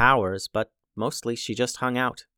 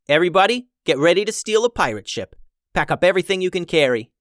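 commanding call; 6 semitones higher, 5 dB louder, faster than usual. A narrator's reading. Captain rallies crew urgently.

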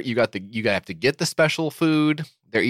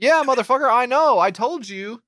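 mock scolding; 9 semitones higher than usual. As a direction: scold the dog like a fed-up owner. Owner scolding the dog.

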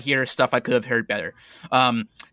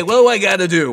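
loud, high, casual demand; exasperated, raised voice; 8 semitones higher, 8 dB louder than usual. Acted. Emperor sitting on his throne, loudly impatient.

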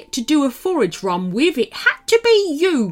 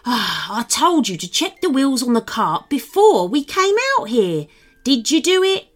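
sigh, then higher scolding; weary gnome rising into reproach; sighing exasperation; 6 semitones higher, 3 dB louder than usual. A narrator's reading. Maximus scolding his partner over the cart, sighing. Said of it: "Maximus sighed".

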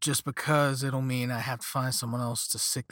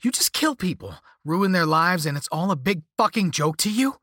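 higher, louder accusation; angry yelling; 5 semitones higher, 6 dB louder than usual. A narrator's reading. Cursed victim accuses caller of ruining lives, yelling. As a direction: yell it in disbelief and outrage. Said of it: "I was yelling now, but I didn't care".

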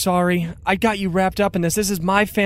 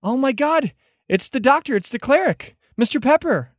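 high, excited character voice; excited recognition; 6 semitones higher than usual. Acted. Prisoner recognizing the cleric in the dungeon, excited recognition. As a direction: exclaim each name in rising delight.

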